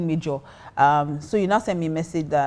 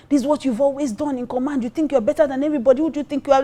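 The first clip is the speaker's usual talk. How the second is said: higher-pitched imitation of someone going off; retold angry tirade; 7 semitones higher, faster than usual. Drunk guy going off on her at a party, imitated tirade. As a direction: rattle off a mock telling-off. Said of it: "the guy went off on me".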